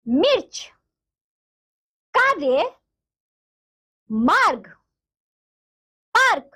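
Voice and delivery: each word read out separately with pauses; clear reading aloud. Shown as a demonstration. Teacher reads each word clearly for the children. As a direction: say every word crisply for the class to repeat. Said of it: "आइए इन शब्दों को हम पढ़ते हैं".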